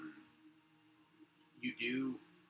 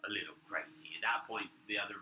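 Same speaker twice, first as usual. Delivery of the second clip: ordinary talk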